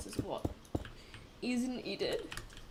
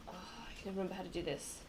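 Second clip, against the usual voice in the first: usual speaking voice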